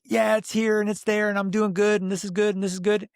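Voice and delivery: high, singsong rattled-off reassurance; mock cheerful. Client brushing off the trainer's questions, mock cheerful. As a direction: imitate a smiling brush-off, pitched well up. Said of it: "you're telling me and you're smiling".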